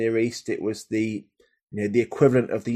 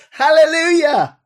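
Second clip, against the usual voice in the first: much higher, louder ringing Hallelujah; joyful shout; 10 dB louder than usual. Acted. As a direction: shout it out like a great multitude. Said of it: "crying out".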